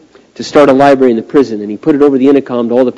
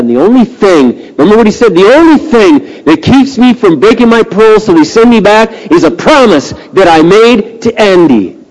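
full-force preaching; 9 semitones higher, 5 dB louder than usual. Acted. Preacher voicing a prisoner afraid to hope, impassioned preaching. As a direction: preach it with long, ringing emphasis. Preaches the quoted line emphatically.

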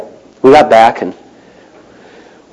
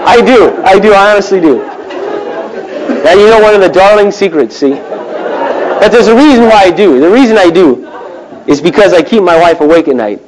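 laughing confession; 7 semitones higher than usual. Real, not acted. Laughing confession about couch.

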